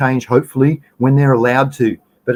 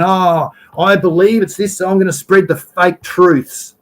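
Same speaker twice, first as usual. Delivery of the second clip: higher, slow, drawn-out mock voice; mocked biased wanker; 6 semitones higher than usual. Host mocks a police chief spreading misinformation, scornful imitation. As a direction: ridicule him with a high, dragged-out imitation. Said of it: "biased wankers like this going".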